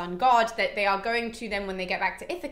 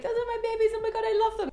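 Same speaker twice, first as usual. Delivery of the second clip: much higher, gushing over his cattle; doting Helios; 11 semitones higher, faster than usual. Acted. Helios gushing over his beloved cattle, high doting coo. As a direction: coo it like a proud doting owner. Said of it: "a really cute speech".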